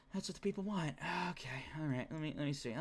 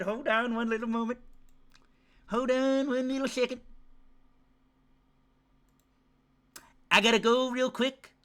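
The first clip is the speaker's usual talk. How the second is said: higher, mocking excuse voice; whiny mock excuse; 5 semitones higher than usual. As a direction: parody a kid sneaking off. Mocking viewers leaving family.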